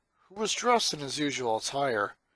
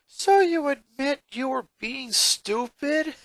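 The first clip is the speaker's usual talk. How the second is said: higher, slow teasing jab; smirking; 11 semitones higher, 4 dB louder than usual. Acted. Lincoln teases his sister, slow smirking boy voice. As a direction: savor catching her out. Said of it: "he smirked".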